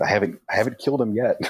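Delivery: quick strained words through gritted teeth. Acted. Veer strangling a man, answering through gritted teeth, fast. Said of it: "Veer will say that through gritted teeth".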